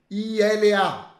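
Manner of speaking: I L A spelled letter by letter, slow and loud; spelled out slowly. Shown as a demonstration. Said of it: "som de L". (soletrando I L A devagar e alto)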